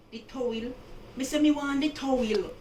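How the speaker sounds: loud, high broad Jamaican patois. Retold or imitated. Playing a country Jamaican demanding the towel, loud patois. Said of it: "In Jamaica, they would say".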